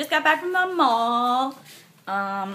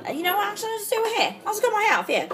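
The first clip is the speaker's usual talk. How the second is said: excited; 11 semitones higher, much faster than usual. Real, not acted. Excited fast high.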